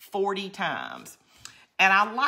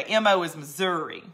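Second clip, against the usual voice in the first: normal talking voice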